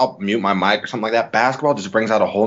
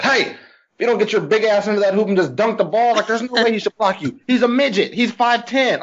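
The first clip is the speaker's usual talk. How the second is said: high, heated yell at a teammate; mock-furious courtside rant; high pitched frustrated yelling; 12 semitones higher than usual. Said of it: "I was just like".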